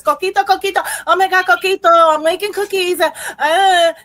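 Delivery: high, loud mock-excited squealing; annoying excited self. Host mimics herself making coquito and cookies, annoyingly excited. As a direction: play up your own over-the-top holiday excitement. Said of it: "I'm annoying".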